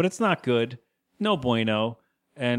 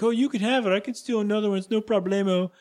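higher, casual stranger voice; diner customer voice; 8 semitones higher than usual. Retold or imitated. Retelling the diner customer giving away his hat, higher. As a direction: toss it off like a carefree guy. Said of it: "And the guy's like".

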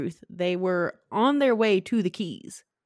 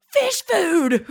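high, loud exclamation; mock alarm; 10 semitones higher, 5 dB louder than usual. Manager in shelter remembers fish food, mock alarmed cry. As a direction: cry out in hammed-up sudden realization. Retold manager's sudden realization.

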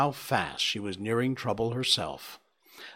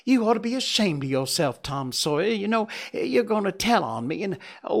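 high, tearful and frantic; crying girl; 8 semitones higher, 3 dB louder than usual. Acted. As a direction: wail it in a panic. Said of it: "burst out crying with shame and vexation".

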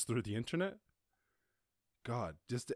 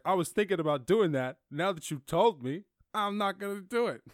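higher, louder mock whiny voice; petulant contrarian; 9 semitones higher, 9 dB louder than usual. A contrarian refusing because he was told, mock whiny and loud. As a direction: play a spiteful brat refusing out of spite. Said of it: "He's like".

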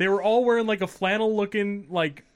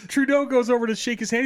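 ordinary delivery

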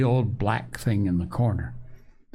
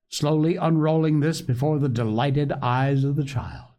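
ordinary delivery, nothing marked

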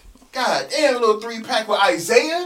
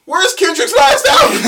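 distressed shout, higher and louder; 12 semitones higher, 8 dB louder than usual. Real, not acted. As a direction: yell it in mock outrage.

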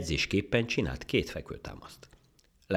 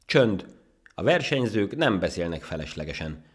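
loud, raised, strict order; stern; 6 semitones higher, 7 dB louder than usual. Acted. Rabbit judge hushing the ant, stern. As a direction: silence the competitors firmly. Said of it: "mondta szigorúan a nyúl".

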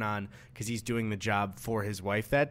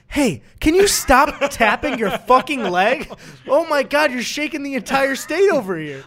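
mock outraged caller; 11 semitones higher, 9 dB louder than usual. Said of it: "imagined earthquake call".

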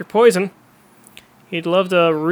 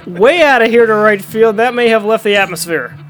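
loud, thrilled call; excited home run call; 3 semitones higher, 6 dB louder than usual. Acted. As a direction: belt it out as the ball leaves the park.

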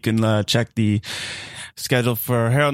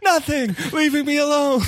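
much higher squeaky reply; high-pitched son voice. Acted. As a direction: answer way up high.

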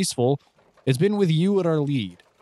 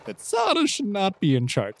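higher-pitched defensive insistence; really defensive; 6 semitones higher than usual. Acted. Clyde objecting to Zana leading, really defensive. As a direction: press the point defensively. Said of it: "Clyde is getting really defensive".

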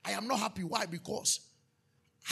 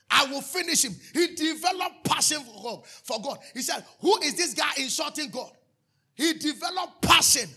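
higher and louder, triumphant; preaching shout; 5 semitones higher, 6 dB louder than usual. Acted. Preacher retelling David facing Goliath, triumphant shout. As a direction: cry it out like David's challenge.

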